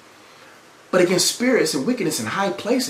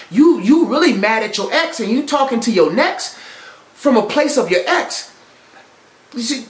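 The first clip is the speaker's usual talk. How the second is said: higher-pitched, insistent sermon delivery; impassioned preaching; 5 semitones higher, 4 dB louder than usual. Real, not acted. Preacher urges congregation to drop old resentment, impassioned preaching. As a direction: drive the warning home to the congregation.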